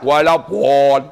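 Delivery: "Walapun" slow, its last vowel stretched and rounded; Javanese-style mispronunciation. Retold or imitated. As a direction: drag out "Walapun" as a mistaken reading. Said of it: "Walapun tu Jawa". (sebutan "Walapun" salah, meleret gaya Jawa)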